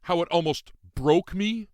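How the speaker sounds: high, loud anguished shout; sudden shout. A narrator's reading. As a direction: fling it at him in sudden anguish. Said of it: "I shouted suddenly".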